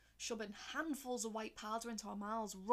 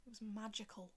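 usual speaking voice

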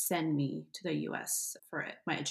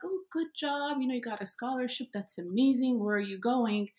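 people congratulating her; 7 semitones higher than usual. Retold or imitated. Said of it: "people would be like".

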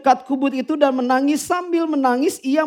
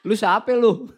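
Betawi line broken by laughter; laughing through it; 3 semitones lower than usual. Pretend. Preacher repeats the Betawi angel line, laughing. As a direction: say the Betawi line while cracking up.

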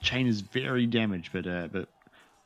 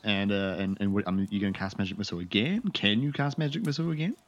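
ordinary delivery

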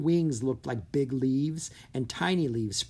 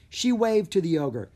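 usual speaking voice

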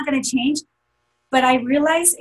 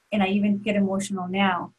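ordinary delivery

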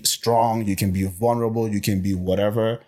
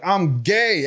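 upbeat announcement; 10 semitones higher than usual. Retold or imitated. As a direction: announce it proudly, pitched well up. Acted announcement of coming out.

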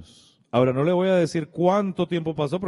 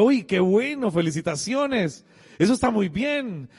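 higher-pitched happy exclamation, retold; delighted congratulations; 7 semitones higher than usual. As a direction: congratulate him with glad surprise. Son congratulating his newly appointed father.

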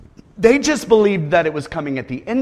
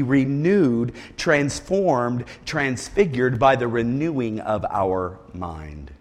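soft slow; 7 semitones lower, 6 dB quieter, slower than usual. Acted. Quiet slow scripture recital.

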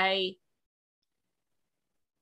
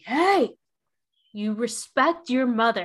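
higher, louder sharp call from the next room; stern dad rebuke; 4 semitones higher, 6 dB louder than usual. Retold or imitated. Dad calling from another room at daughter, stern raised rebuke. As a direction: cut in firmly to defend the mother. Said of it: "Scott out of the other room was like".

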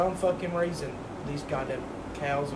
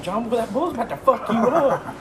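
louder, higher call across the field; shouted warning; 7 semitones higher, 7 dB louder than usual. Retold or imitated. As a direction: yell a warning to your friend. Calling out warning to friend.

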